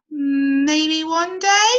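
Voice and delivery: trailing off, not convinced; hesitant caterpillar. Acted. Cody unsure.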